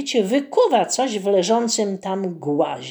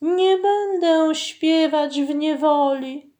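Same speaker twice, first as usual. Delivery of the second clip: mermaid refusing; 8 semitones higher than usual. A narrator's reading.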